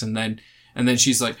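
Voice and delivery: ordinary talk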